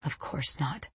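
low, very soft reassurance; dad's whisper. A narrator's reading. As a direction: whisper close against the child's head. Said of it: "Dad whispers against my head".

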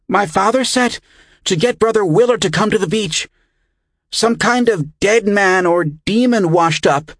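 put-on high, loud, breathless boy; out of breath. A narrator's reading. Winded boy reporting a body on the beach, out of breath. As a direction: blurt out urgent news in a high child's voice. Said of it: "still out of breath".

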